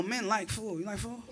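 ordinary talk

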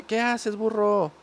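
ordinary talk

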